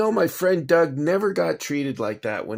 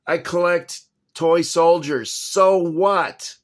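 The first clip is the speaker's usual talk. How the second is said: raised, louder, defiant protest; angry outburst; 5 semitones higher, 7 dB louder, slower than usual. Real, not acted. Collector defending his hobby to the rude man, angrily loud. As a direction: fling it back at the man who glared. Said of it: "I get mad".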